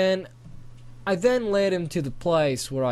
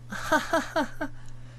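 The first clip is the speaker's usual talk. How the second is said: embarrassed laugh; 14 semitones higher than usual. Acted. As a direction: laugh along sheepishly. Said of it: "decided to just laugh too".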